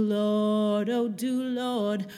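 ordinary delivery

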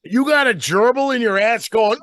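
ordinary talk, nothing marked

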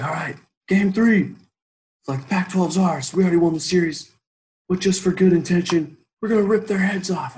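a warlord promising no mercy; war speech. Retold or imitated. Final war speech to team.

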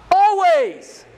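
loud, high proclamation; ringing, high-pitched pulpit cry; emphatic exclamation. Real, not acted. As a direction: proclaim it loudly, pitched right up. Climax of preacher's question and answer.

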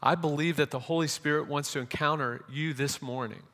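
rising, fervent sermon build over music; preaching with music. Real, not acted. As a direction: preach it with growing conviction.

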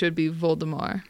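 Voldemort with a silent T; Voldemort said the French way; French pronunciation. Shown as a demonstration. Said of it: "But in French".

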